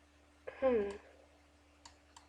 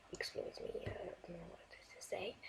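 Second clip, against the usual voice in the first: hushed apologetic whisper; 6 semitones lower, 22 dB quieter than usual. Real, not acted. Streamer excusing herself to someone nearby, whispering. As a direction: whisper it off-mic, embarrassed.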